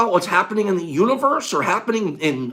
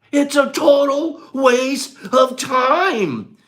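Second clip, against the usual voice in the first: slow, louder, crying it out; drawn-out cry; 6 semitones higher, 7 dB louder, much slower than usual. Shown as a demonstration. Teacher wanting to scream the point, a slow cry. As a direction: scream it slowly to the room. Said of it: "I wanna scream it".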